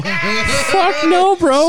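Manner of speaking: squeaky outraged no; high mock refusal. Refusing to take the wheel, squeaky mock outrage. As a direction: yelp it up high in disbelief.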